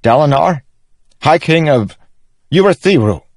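high, loud improvising king; insistent young king. Acted. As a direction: work out the titles aloud, excitedly. Narrator voices Elhokar.

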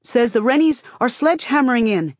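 high, loud urgent call. A narrator's reading. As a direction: shout the news breathlessly. Runner boy calling news.

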